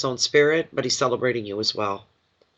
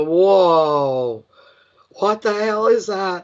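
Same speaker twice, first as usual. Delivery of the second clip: a high, loud "whoa" of mock shock, then slower; retold shock; 5 semitones higher, 5 dB louder, much slower than usual. Reader retelling her card reaction to Nick, mock shocked. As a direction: replay the moment the card hit you. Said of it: "It was just a".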